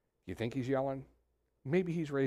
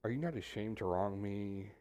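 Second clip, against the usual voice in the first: softer, low and restrained; quiet, calm take; 3 semitones lower, 6 dB quieter than usual. Shown as a demonstration. Preacher trying Job's question the calm way, softly. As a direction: ask it gently, almost under your breath. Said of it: "let me try to do it two ways".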